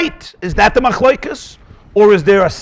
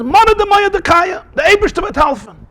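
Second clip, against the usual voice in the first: fervent quote; 11 semitones higher than usual. Retold or imitated. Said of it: "So he says".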